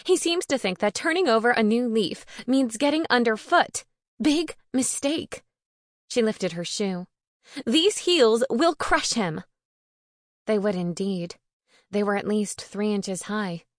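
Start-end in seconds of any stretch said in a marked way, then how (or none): 0.0-5.4 high-pitched affronted complaint, slowing to a threat; put-out and higher-pitched; huffy indignation. A narrator's reading. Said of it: "she huffed". 7.6-9.4 high, loud, slow menace; indignant, deliberate warning; huffy threat. A narrator's reading. Huffy character threatens a rival.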